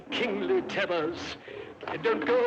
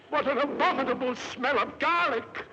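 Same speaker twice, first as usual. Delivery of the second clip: ordinary talk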